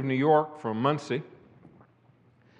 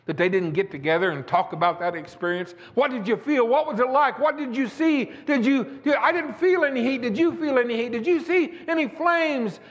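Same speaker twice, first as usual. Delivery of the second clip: high, ringing pulpit cadence; fiery preacher rolling through the testimony; preaching at full force; 7 semitones higher than usual. Acted. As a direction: preach it out to the congregation, building. Preaching, acting the Hebrew children.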